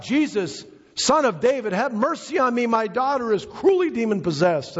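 high, pleading cry for mercy. Retold or imitated. Preacher voicing a woman begging Jesus for her daughter, crying out high. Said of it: "the woman began to cry out".

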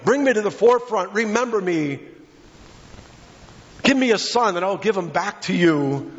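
high, pleading cry of Hannah; preached plea. Acted. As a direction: beg to be remembered.

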